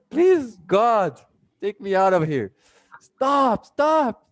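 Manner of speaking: high, loud mock cry to heaven; mock desperate plea. Retelling a bad date, begging God to end it. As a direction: ham up a desperate prayer to God. Pleading to escape a boring date.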